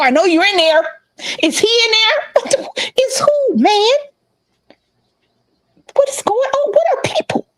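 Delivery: a mock-tearful rant through the door, ending in a breathy whisper; frantic ex at door. Ex beating on her door, imitating his frantic crying rant. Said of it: "when he was out there beating on the door".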